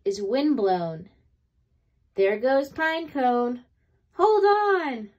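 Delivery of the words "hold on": high, loud cry over the storm; calling out. A narrator's reading. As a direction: shout to be heard in the wind. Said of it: "calls out stone".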